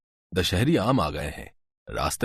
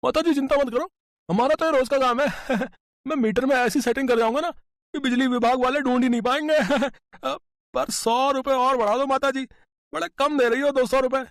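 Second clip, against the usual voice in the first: cheeky electrician. Acted. Electrician boy haggling with the old mother for more bribe, sly and high. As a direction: play a greedy shop boy haggling with a laugh. Electrician bargaining, much higher voice.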